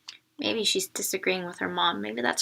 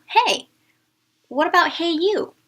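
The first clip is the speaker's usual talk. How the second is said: teasing; 7 semitones higher, 8 dB louder than usual. Acted. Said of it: "the boy said".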